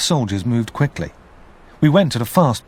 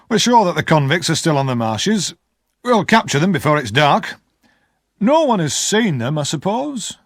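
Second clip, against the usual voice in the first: a hint of a brisk army sergeant, higher; lightly voiced sergeant; 6 semitones higher than usual. Sergeant questioning the household.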